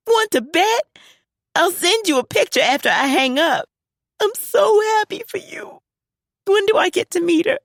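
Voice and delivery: very high, near-shouting with joy; overjoyed daughter. Acted. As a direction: burst with happiness for dad.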